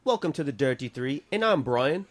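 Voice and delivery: mock Australian accent on a host intro; a put-on Aussie accent, slowing at the end; Australian accent telling a silly story. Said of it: "I don't know why I'm Australian".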